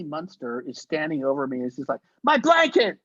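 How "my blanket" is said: screaming. Retold or imitated. Actress finds crew guy on her blanket, high screaming. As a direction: shriek at him with a much higher pitch. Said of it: "She starts screaming at me".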